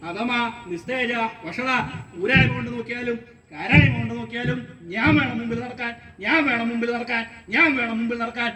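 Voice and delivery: rapid, rhythmic volley of insults; squabbling man insisting on his precedence; quarrelling Brahmin. Acted. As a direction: rattle off the boast like a repeated refrain. Acts the quarrelling men.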